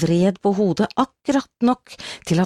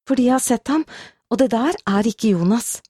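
mildly trembling, higher pitch; 6 semitones higher than usual. A narrator's reading. Woman insisting it is not Jonas, voice trembling a little. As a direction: answer with a touch of a quaver, pitched up. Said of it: "Stemmen skalv".

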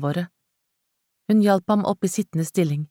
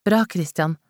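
plain reading voice

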